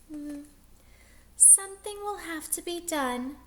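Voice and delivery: high, soft musing teacher voice; gentle teacher pondering a plan; thoughtful Miss Nelson. Acted. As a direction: wonder aloud, quietly worried.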